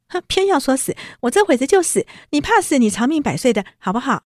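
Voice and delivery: 带一声哼的高声赌气; 尖着嗓子负气顶撞; 赌气气话. Retold or imitated. 黛玉赌气说死. (高声负气地顶嘴)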